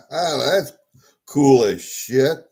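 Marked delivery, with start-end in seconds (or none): none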